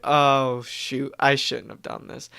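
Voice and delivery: ordinary talk, nothing marked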